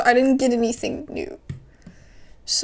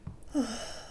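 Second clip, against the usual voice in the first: weary sigh; 11 dB quieter than usual. Real, not acted. After a bad day.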